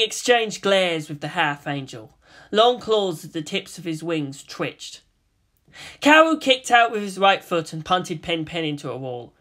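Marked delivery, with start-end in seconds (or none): none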